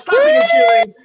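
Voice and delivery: high, loud shout; rousing the crowd. Real, not acted. Host asking audience to cheer, rousing shout. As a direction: belt it out to raise cheers.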